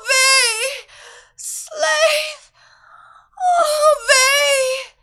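very high, loud frenzied cries; ecstatic shouting. A narrator's reading. Captive near climax, shrieking high and loud. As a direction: climax in full cry.

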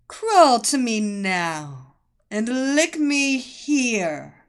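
sultry commanding mistress; commanding seductress. Acted. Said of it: "the voice commanded".

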